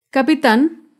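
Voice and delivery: "Capitán" as ca-pi-TÁN, final syllable stressed; careful teaching demo of "Capitán", weight on the end; stress on last syllable. Shown as a demonstration. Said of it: "Se acentúa en la última sílaba".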